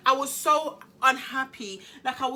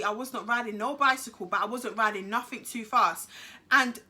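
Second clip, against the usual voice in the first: ordinary delivery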